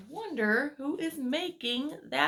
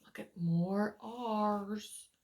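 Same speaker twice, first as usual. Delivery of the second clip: ordinary delivery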